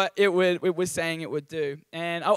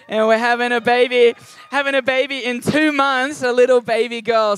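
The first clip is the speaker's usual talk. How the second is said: higher and louder with real excitement; excited announcement; 4 semitones higher, 8 dB louder than usual. Real, not acted. Pastor telling the church his wife is expecting, thrilled. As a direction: burst out with the happy news.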